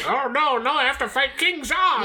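high, panicked hero cry; video game hero. Pretend. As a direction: squeal like a game hero facing the boss.

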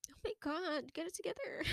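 very soft, high, tearful self-scolding; crying. Real, not acted. Podcaster telling themselves to recover, crying softly. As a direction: pull yourself together through real tears. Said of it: "I'm still, like, crying over it".

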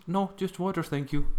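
high, prim polite refusal; prim polite guest. Acted. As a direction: answer like a prim little guest. Acting out the guest's answer.

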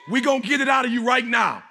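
high, loud surge to the crowd; forceful rallying cry. Real, not acted. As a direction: burst out at full preaching force. Preacher surges to fire up crowd.

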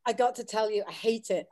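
usual speaking voice

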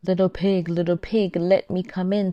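loud, coaxing wolf voice; big bad wolf. A narrator's reading. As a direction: call out like a hungry wolf. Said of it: "said the wolf".

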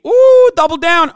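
loud high-pitched thrilled ooh; delighted ooh. Real, not acted. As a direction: squeal with glee at the double down. Gleeful reaction to doubling down.

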